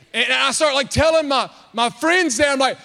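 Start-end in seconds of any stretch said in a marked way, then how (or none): none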